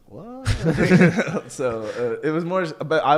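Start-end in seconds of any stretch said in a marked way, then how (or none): 0.1-0.5 high squeaky puzzled what; baffled what. Retold or imitated. Retelling his confusion as a new kid, a high baffled what. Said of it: "I was like". 0.5-1.5 a burst of loud laughter; bright laugh. Real, not acted. Friends hear his school story, a loud burst of laughter.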